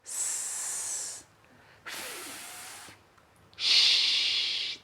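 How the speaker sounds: hissed s, f, sh with air only; s, f, sh breathed out without voice; isolated voiceless sounds. Shown as a demonstration. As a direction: push air, no voice. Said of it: "when you make the sounds s".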